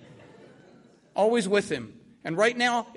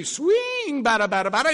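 infield chatter; 3 semitones higher, 3 dB louder than usual. Retold or imitated. Joker heckling the batter, sing-song chatter. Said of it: "when he would say".

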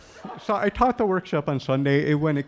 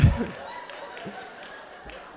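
breathy laughter; 8 semitones higher than usual. Real, not acted. Laughs at own story.

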